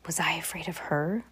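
fearful whisper. Acted. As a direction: breathe it out, afraid. Whispered eerie narration.

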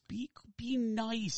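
high, slow, pleading; exasperated plea. Real, not acted. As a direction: stretch it out, begging them.

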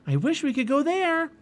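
put-on very high, wishful girl; eager Annie. A narrator's reading. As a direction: wish aloud keenly in a high voice. Annie longs for the Arctic.

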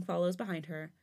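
usual speaking voice

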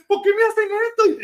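lloriqueo agudo y fuerte, de burla; llanto burlón. Retold or imitated. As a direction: imita al tipo llorando mientras lo arrestan. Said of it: "donde tú estás llorando". (lloriqueo exagerado y burlón, agudo)

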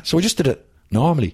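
usual speaking voice

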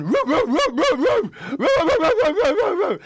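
high loud barking roo roo; dog bark impression. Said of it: "savage impression of a dog".

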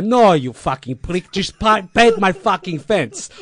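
put-on irritated old-school wrestling boss; annoyed mentor. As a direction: bark it at the cheeky kid. Lou snapping at young Mark.

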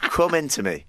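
laughing through the title; giggling out the words. Real, not acted. Laughing while saying title.

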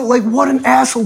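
normal talking voice

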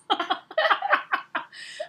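high-pitched burst of laughter; amused laugh. Real, not acted. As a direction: crack up laughing. Amused by spanking comment recalled.

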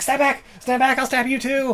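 high, loud, frantic threat; frantic shout. Retold or imitated. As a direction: scream it like a cornered madman. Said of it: "he's like".

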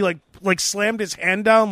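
ordinary talk

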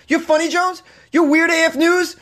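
very high, thrilled disbelief; excited fan. Retold or imitated. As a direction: squeal it like a starstruck fan. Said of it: "He goes".

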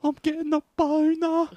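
high, slow squeaky mock moan; hammed-up Ron. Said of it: "And he's like".

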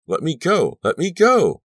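desperate, escalating shriek. A narrator's reading. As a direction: scream to be released. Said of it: "I shrieked".

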